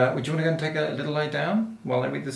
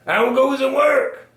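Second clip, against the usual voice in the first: calling farmer; 11 semitones higher, 6 dB louder than usual. A narrator's reading. Farmer's repeated call in story.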